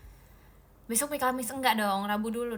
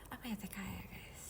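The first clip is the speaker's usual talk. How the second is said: a sheepish whispered confession; 3 semitones lower, 13 dB quieter than usual. Real, not acted. Whispering an embarrassed admission to the stream. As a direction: drop to a voiceless whisper. Confessing she doesn't know.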